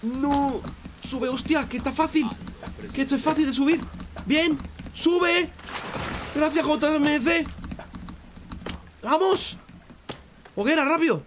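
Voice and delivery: real excited yelling, voice way up; excited shouting. Real, not acted. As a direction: cheer yourself up the cliff. Urging climb, shouting at game.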